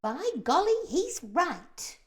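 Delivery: high, loud startled agreement; centipede crying out. A narrator's reading. The centipede suddenly agrees with the earthworm, alarmed. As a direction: blurt it out in alarm. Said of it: "cried the centipede".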